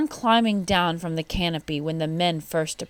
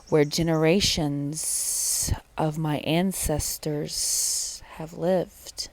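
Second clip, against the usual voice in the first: slow, hushed snake voice drawing out the words; hissing snake; much slower than usual. A narrator's reading. Snake hissing in the sleeping man's ear, slow and hushed. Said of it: "hissed in his ear".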